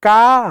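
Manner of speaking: का as one long "kaa"; का drawn out, pitch lifted; blended syllable. Shown as a demonstration. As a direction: stretch the aa in का. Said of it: "अब का बोलो".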